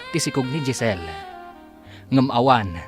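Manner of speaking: ordinary talk, nothing marked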